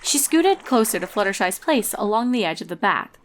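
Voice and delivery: plain reading voice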